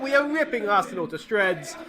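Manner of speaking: excited gloating; gleeful. Real, not acted. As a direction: gloat with delight. Celebrating the third goal.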